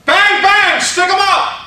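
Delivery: loud, high stick-up shout; shouted demo. Shown as a demonstration. Speaker showing the audience what to yell, loud shout. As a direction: bark it out like a robber. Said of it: "I would like for you to do the following".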